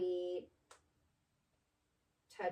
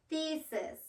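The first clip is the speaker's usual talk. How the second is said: clear model word; 8 semitones higher than usual. Shown as a demonstration. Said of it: "let's try".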